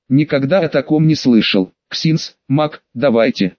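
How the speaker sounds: ordinary delivery, nothing marked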